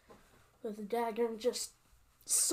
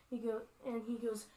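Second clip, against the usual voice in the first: ordinary delivery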